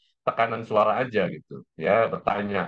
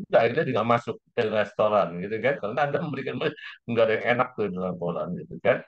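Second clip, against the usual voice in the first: ordinary delivery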